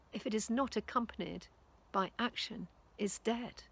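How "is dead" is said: ordinary talk, nothing marked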